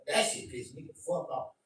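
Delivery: ordinary delivery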